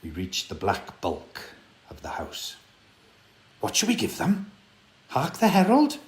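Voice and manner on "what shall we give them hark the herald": put-on eager boy. A narrator's reading. Boys whisper-arguing at door.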